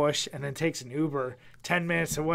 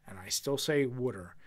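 water said "wooder" in a Philly accent; Philly native saying "wooder"; 6 semitones lower, 7 dB quieter than usual. Shown as a demonstration. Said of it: "we always get the looks".

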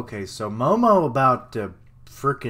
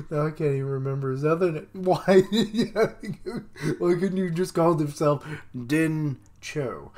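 real giggling, raised voice; laughing; 5 semitones higher than usual. Real, not acted. Reader forgets a character's name, laughing. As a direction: talk through helpless laughter.

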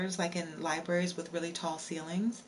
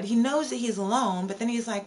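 usual speaking voice

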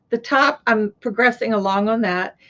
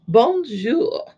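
put-on French accent. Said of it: "it'd be like if I was going to France".

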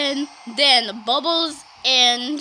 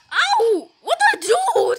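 startled ow; 8 semitones higher than usual. Pretend. Character hurt and startled.

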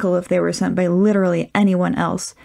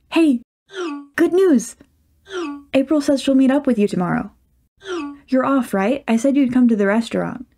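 higher, bright rush of good news; eager, higher pitched cheer; bubbly excited friend; 7 semitones higher than usual. Acted. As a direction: burst out with the news. Sunita's excited texts voiced.

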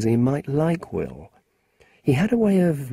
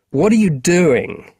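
louder, slower, higher angry demand; angry question; 7 semitones higher, 5 dB louder than usual. Acted. Will angrily confronting Marcus at the bus stop. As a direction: snap at the runaway boy. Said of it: "asked Will angrily".

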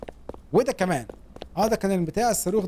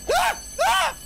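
two very high-pitched yelps; high gamer panic scream; startled shriek; much slower than usual. Real, not acted. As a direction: yelp in sudden alarm, way up high. Startled mid-game by trouble.

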